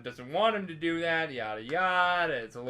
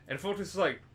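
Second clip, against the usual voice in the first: normal talking voice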